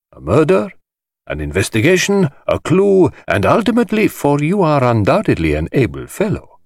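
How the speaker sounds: high, showy voice of a flamboyant collector; theatrical host. Acted. The host lecturing the detective, grand and high-pitched. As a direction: relish every word like a showman.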